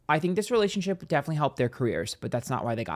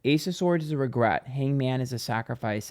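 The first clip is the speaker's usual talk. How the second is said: ordinary talk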